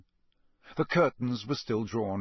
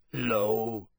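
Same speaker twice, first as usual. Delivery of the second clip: hoarse. A narrator's reading. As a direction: rasp it out. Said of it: "in a very hoarse voice".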